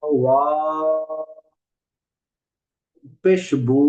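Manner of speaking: ওয়া then পেশ বু, with বু held one alif long; vowel lengthening demo; stretched vowel demo. Shown as a demonstration. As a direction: draw out বু like a teacher showing madd. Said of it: "বাকে এক আলিফ টান দিলাম বানানে".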